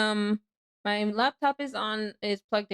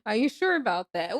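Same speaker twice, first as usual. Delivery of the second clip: usual speaking voice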